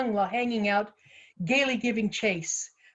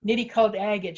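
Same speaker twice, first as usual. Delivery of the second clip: ordinary delivery, nothing marked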